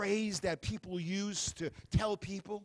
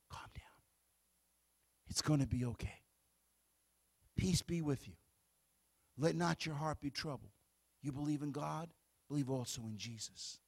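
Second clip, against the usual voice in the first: calm reassurance; 8 semitones lower, 8 dB quieter than usual. Shown as a demonstration. Preacher shows the flock how to calm someone, soft and low. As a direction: settle a frantic person gently with your voice. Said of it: "look into their eyes and say".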